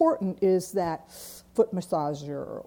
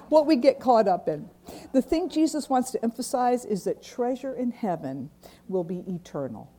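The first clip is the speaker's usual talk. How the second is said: ordinary talk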